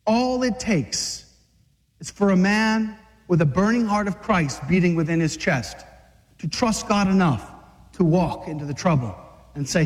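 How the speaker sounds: ordinary delivery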